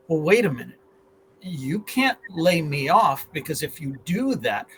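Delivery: ordinary talk, nothing marked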